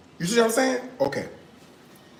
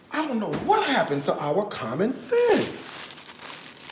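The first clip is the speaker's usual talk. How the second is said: slow, higher-pitched lamenting; mock lament; 4 semitones higher, 3 dB quieter, slower than usual. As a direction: stretch it out in mock despair.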